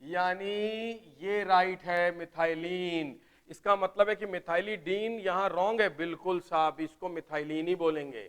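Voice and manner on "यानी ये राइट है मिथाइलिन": slow emphasis. Shown as a demonstration. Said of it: "हम इसको बोलते हैं मिथाइलिन".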